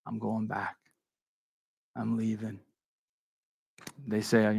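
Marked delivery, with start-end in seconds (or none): 0.1-2.6 much softer, lower, defeated; quiet resignation. Retold or imitated. Preacher voicing a discouraged Peter telling disciples he's leaving, quietly. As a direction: let it sink, giving up.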